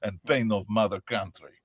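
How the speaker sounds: loud, mock-tragic Russian accent; pained Russian accent. Shown as a demonstration. Russian suffering for the mother country, pained Russian accent. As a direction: lament it with a big Russian sound. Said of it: "For Russians, everything is pain".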